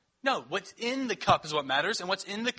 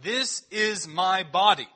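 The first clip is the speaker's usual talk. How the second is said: stretched out, louder and slow; slow emphatic reading; 2 semitones higher, 6 dB louder, slower than usual. Acted. Pastor giving Jesus' words at the table, slow and emphatic. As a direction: lean hard on every word.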